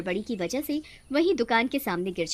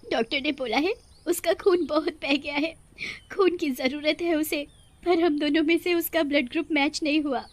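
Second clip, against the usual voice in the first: grieving mother; 4 semitones higher than usual. Acted. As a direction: speak as a frightened mother pleading. Shop owner's wife explains. (दुखी माँ की घबराई आवाज़)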